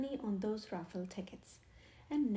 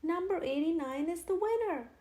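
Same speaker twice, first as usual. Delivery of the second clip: higher, louder urgent news; 6 semitones higher, 6 dB louder than usual. A narrator's reading. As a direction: blurt it out in a hurry. Stanley breathless report.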